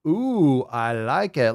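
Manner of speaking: ordinary talk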